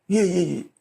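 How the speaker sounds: put-on timid, hurried yes-yes-yes of a scared kid; imitating a frightened kid; cowed victim. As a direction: cower and agree fast. Bullied kid answering the thug.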